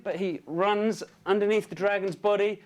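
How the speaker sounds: ordinary delivery, nothing marked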